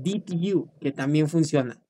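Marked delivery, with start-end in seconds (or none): 0.0-0.7 "did you" softly, as two separate words; distinct "did you". Shown as a demonstration. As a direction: keep "did" and "you" apart, quieter. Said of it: "no te olvides".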